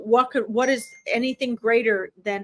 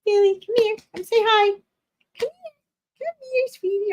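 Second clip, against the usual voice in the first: sweet pet talk; 12 semitones higher than usual. Real, not acted. Medium calling the spirit dog over in sing-song pet talk.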